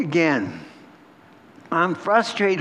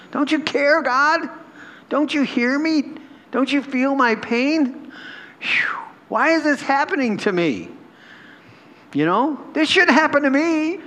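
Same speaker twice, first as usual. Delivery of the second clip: high, pained plea; pleading with God; 9 semitones higher than usual. Acted. Believer crying out to God in the night, high and pleading. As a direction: beg God to notice the pain. Voicing our cries to God.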